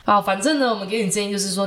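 ordinary delivery

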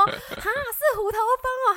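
high, slow mock gasp; startled. Answering the pest man about the hornets, mock startled. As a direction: act amazed it's hornets. Speaker retells own startled reply.

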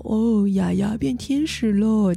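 slow mock-innocent child voice stirring things up; teasing little sister. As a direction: drag it out like a kid needling her sister. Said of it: "还在旁边煽风点火".

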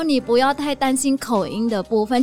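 normal talking voice

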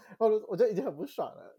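ordinary talk, nothing marked

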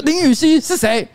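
slow, high, outraged demand to know who she is; exasperated demand. Retold or imitated. Streamer retelling how he angrily asked his assistant, in flustered fury. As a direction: spit out the question in flustered anger. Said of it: "很气急败坏的问".

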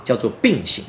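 usual speaking voice

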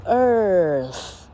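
Earth stretched as "errr-th"; slow model of Earth with a long "er"; long r-coloured vowel. Shown as a demonstration. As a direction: hold the curled vowel opening Earth. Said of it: "也是这样念".

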